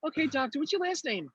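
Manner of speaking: very high-pitched mock clerk. Clerk asking doctor for his name, high mock voice. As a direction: squeak the question like a chirpy server.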